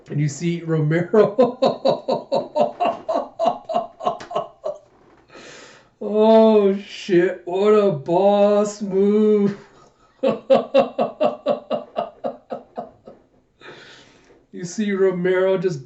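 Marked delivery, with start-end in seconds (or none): 1.6-4.8 high, breathy laughing fit; long peal of laughter; real laughter. Real, not acted. Delighted at Romero's move. 6.1-9.6 loud, high, stretched-out amazement; real impressed outburst; awed excitement. Real, not acted. Reader amazed at the move. 10.2-12.8 high, breathy laughing; long giddy laughing fit; real laughter. Real, not acted. Delighted at Romero's move.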